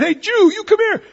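high, loud jeering call; taunting shout. Retold or imitated. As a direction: bark the insult across at him. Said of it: "he sees this guy saying".

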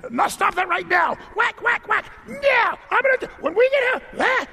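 very high, loud screeching mother; hammed-up scolding mom losing it in a store; yelling Kmart mom. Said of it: "Don't be the Kmart mom".

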